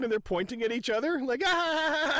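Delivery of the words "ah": high mock shriek of laughter; giddy howl, acted out; imitated laughing howl. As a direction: let out a high, put-on cackling cry. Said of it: "walking away laughing".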